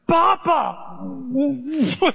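put-on high, loud, joyful little girl's cry of Papa; delighted child. Granddaughter spotting her grandpa: excited high "Papa!". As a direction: squeal it like a thrilled grandchild.

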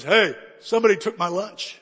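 put-on big kid's loud, outraged call; loud accusation. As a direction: call out the theft to the whole class. Big kid finds lunch stolen.

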